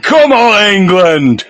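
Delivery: loud, high rallying shout; Brian Clough. Pretend. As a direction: end the mock team talk with a big cheer. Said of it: "I'm doing a Clough".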